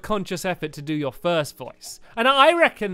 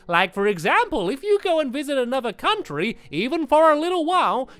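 silly voice; 6 semitones higher than usual. Shown as a demonstration. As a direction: stretch the vowels and keep the pitch up. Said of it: "that was a bit of a silly voice".

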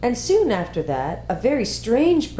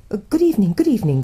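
louder, fussy, doubled greeting; faun's flustered greeting; 4 semitones higher, 5 dB louder, much faster than usual. A narrator's reading. The faun, still gathering parcels, greets the girl in a fussy, louder voice. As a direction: fluster through the repeat.

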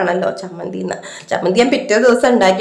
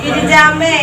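calling the neighbour's name, higher and louder; 4 semitones higher, 4 dB louder, slower than usual. Real, not acted. Mother calling out to neighbour Girijamma from the kitchen, raised call.

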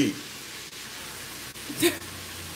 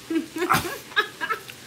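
a high giggle at the memory; high laugh; 8 dB quieter than usual. Real, not acted. Laughs at the dead bird.

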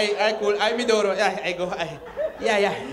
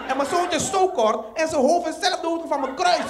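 ordinary talk

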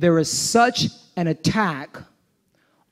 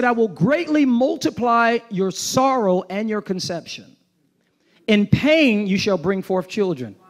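preaching scripture reading; 6 semitones higher than usual. Acted. Reads curse on woman.

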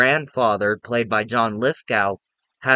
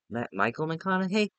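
Michael McConaughey? rising higher and much softer, doubting; unsure question; 5 semitones higher, 8 dB quieter than usual. Real, not acted. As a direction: catch the name slip and question it. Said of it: "Matthew McConaughey".

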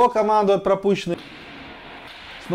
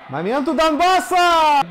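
excited; 8 semitones higher, 5 dB louder, slower than usual. Real, not acted. Scoring chance called.

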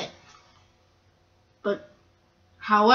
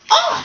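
very high, loud "oh"; sudden yelp on impact; pained cry; 6 dB louder than usual. Acted. Freight cars get bumped hard, pained cry.

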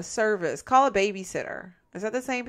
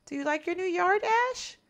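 talking to dog; 9 semitones higher than usual. Real, not acted.

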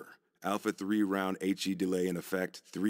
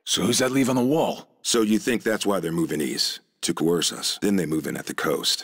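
radio comms voice; 9 dB louder than usual. Acted.

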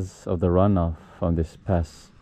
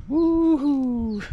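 joyful woohoo. Real, not acted. Pushing himself on the swing, gleeful high woohoo.

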